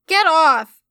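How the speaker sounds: loud, high yell of get off. A narrator's reading. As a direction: yell to shake her off. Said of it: "she yelled in response".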